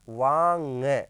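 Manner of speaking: वांग loud and drawn out into nasal nga; sounded-out pronunciation. Shown as a demonstration. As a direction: stretch वांग as waa-nga, projecting it. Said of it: "तो ये हो जाएगा क्या?". (वांग को ज़ोर से खींचकर "वा-ङ" बोलें)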